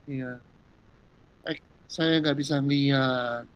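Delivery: low, hushed, slow admission; subdued confession. Retold or imitated. Bartimaeus finally telling Jesus his need, hushed and slow. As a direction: say it quietly, drawn out and low.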